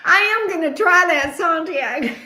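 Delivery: high, giggly voice mid laughing fit; talking through laughter. Real, not acted. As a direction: push the words out through real laughter. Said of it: "Why am I laughing now?".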